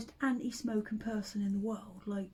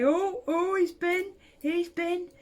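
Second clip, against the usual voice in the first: put-on high, loud, excited old nan; hammed-up nan voice, pitched high and loud; old nan impression; 6 semitones higher, 7 dB louder than usual. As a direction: do an old nan crowing with delight. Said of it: "I can do a very good impression of the old nan".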